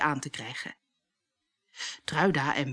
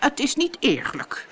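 high-pitched sulky pout; 8 semitones higher than usual. A narrator's reading. Bertha sulking in a corner at the ball, pouting. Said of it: "pruilde Bertha".

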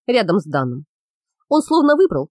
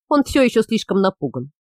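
ordinary delivery

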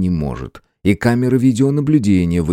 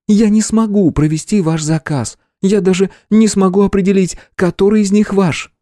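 stubborn; 12 semitones higher, 3 dB louder than usual. A narrator's reading. Clerk stubbornly refusing robbers.

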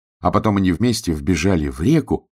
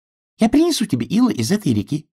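little duck voice; 10 semitones higher than usual. Acted.

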